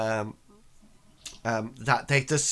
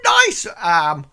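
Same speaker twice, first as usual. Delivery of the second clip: put-on very high, loud, slow old lady voice; old woman; 7 semitones higher, 10 dB louder, slower than usual. Old woman gushing at the young hero. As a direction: coo over the young man. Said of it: "old woman voiced by GM".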